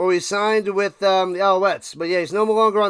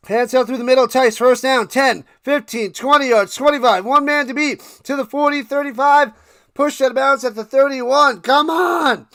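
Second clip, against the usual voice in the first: louder, higher live call of the run; excited play-by-play; 5 semitones higher, 6 dB louder than usual. Acted. Streamer calling the kick return for his chat, excited play-by-play. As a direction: call the big return like a broadcaster, building.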